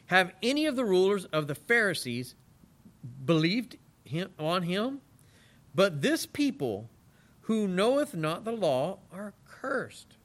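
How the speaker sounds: slow, weighty Bible reading; scripture reading. Acted. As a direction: read the verse slowly and solemnly. Reads scripture aloud.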